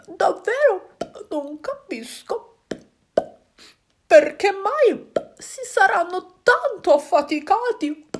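a wondering little fish. Acted. Fish wonders at the animals, in a slow high dreamy voice.

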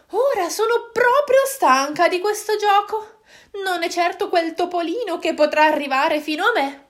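a bored moon huffing; huffy moon. Acted. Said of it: "sbuffò".

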